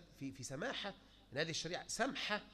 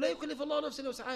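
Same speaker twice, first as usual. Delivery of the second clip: urging the preacher to quote the verse, higher and faster; raised, fast recitation; 7 semitones higher, much faster than usual. Retold or imitated. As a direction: recite لا يكلف الله نفسا high and quick. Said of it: "يا أخي، قول".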